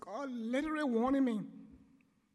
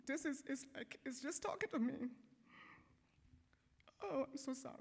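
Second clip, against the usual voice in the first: emotional; 2 semitones higher, 6 dB quieter than usual. Real, not acted. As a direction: softly overcome with real emotion. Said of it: "I didn't mean to get emotional".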